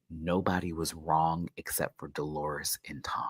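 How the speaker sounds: hushed whispered aside; soft whispered emphasis. Real, not acted. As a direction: drop to a whisper as if sharing a secret. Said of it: "whispered emphatic point".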